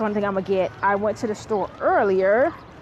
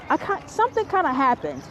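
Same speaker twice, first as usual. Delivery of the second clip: ordinary talk, nothing marked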